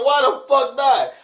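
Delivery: loud, high, slow why the fuck not; high outraged yell. Retold or imitated. To the boss who scolded him, a high outraged yell.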